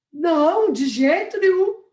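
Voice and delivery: loud, high insistent no; emphatic denial. Shown as a demonstration. Asked if she is tired, she loudly insists not. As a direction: deny being tired, forcefully and loudly. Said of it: "Ô, [name], você tá cansada?".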